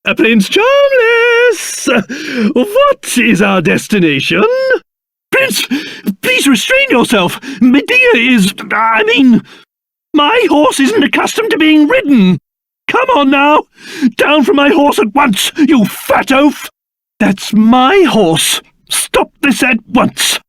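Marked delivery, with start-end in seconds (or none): 0.0-1.9 high, drawn-out hail; calling out. Acted. Calling out to the prince. 10.1-16.7 high-pitched, spluttering indignation; indignant scolding. Acted. Scolding the prince off the horse.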